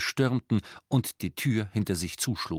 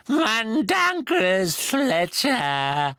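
very slow, high, louder croak; croaking elf; 12 semitones higher, 5 dB louder, much slower than usual. A narrator's reading. Creature confessing to Harry with eyes shut. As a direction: croak the name out painfully. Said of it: "krächzte der Elf".

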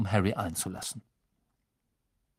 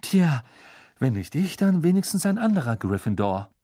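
excited Angelina; 5 semitones higher, 5 dB louder than usual. Acted. Angelina cheers Harry on.

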